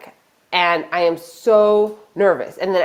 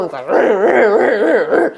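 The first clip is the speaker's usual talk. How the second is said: imitated snarling dog; loud, drawn-out mock dog growl; 3 semitones higher, 4 dB louder, much slower than usual. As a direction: snarl like a dog tearing at a bag. Imitates the attacking dog's snarl.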